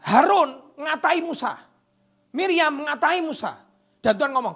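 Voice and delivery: high, loud and slow; slow thundering sermon line; slow emphatic preaching. Real, not acted. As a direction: hammer each name home. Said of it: "emphatic slow preaching".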